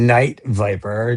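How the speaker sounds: ordinary delivery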